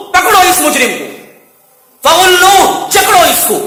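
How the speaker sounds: stern booming order to seize the sinner; commanding shout. Acted. Angels ordered to seize the culprit, booming shout. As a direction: bellow the command to grab him.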